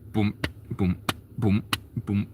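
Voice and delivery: low, soft booming drum sounds; low drum booms. Retold or imitated. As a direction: mouth the bass drum in a low voice. Imitating snare on two.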